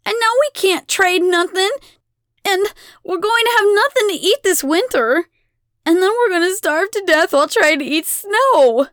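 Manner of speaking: put-on high, loud breathless-child outrage; distressed shouting. A narrator's reading. Abigail tells the goddess of coming hunger, shouting. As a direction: pile up the grievances at a shout. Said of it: "Abigail shouts".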